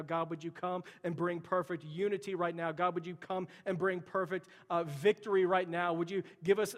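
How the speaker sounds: loud, urgent public prayer; fervent prayer. Real, not acted. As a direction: plead with God at full force, pitch lifted. Prays aloud at full force.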